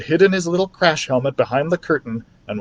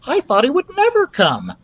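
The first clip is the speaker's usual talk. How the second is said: high little mouse voice, much higher; mouse Ralph; 14 semitones higher than usual. A narrator's reading. Ralph the mouse relieved at the father's return, high mouse voice. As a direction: squeak it out in relief.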